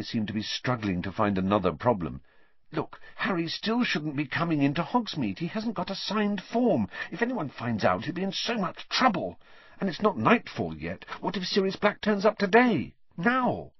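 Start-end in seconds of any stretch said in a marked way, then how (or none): 2.7-13.7 put-on high, worried girlish voice rising in alarm; fretting schoolgirl piling up dangers; anxious Hermione. A narrator's reading.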